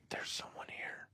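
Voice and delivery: very soft whispered warning; imitated fearful whisper. Girlfriend warning partner someone is in the room, whispered. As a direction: whisper it so the intruder won't hear. Girlfriend warns of intruder at night.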